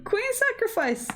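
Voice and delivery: high, thrilled exclamation; excited shout. Real, not acted. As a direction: cry out the move in delight. Repeating the move name in shock.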